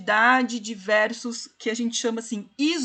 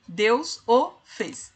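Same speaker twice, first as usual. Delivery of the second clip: "Deus o fez" slowly, one beat each; counting the meter slowly; slow scansion; slower than usual. Shown as a demonstration. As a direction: spell out three syllables. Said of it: "Três sílabas".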